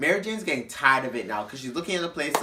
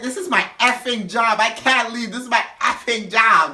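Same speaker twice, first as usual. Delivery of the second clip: high, loud mock tirade; imitating an indignant drunk worker; going off; 5 semitones higher, 5 dB louder than usual. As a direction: protest insistently, pitched up. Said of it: "full on going off".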